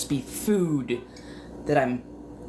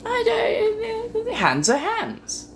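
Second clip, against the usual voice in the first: exasperated wail; much slower than usual. Real, not acted. Breaking wail over hands.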